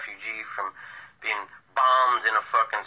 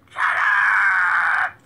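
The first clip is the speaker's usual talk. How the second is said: a sudden, furious bark from the couch; angry shout; 10 semitones higher, 8 dB louder than usual. Retold or imitated. Dad on the couch yelling at the excited dog, loud angry shout. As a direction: yell it at the barking dog. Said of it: "My dad yells at her".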